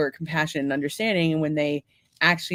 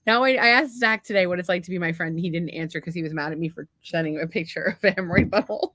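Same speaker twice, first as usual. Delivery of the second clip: ordinary talk, nothing marked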